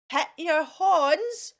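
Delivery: ordinary talk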